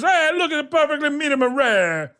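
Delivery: high, hammed-up show-off cook; chef character voice. Comedian mimics the TV cook showing his pork, character voice. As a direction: present the dish in a high put-on voice. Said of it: "he goes".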